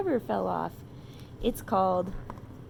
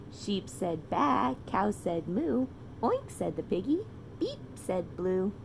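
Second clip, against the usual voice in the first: put-on reading to children. A narrator's reading. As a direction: read it like a bedtime story to a toddler. Reading animal sounds to child.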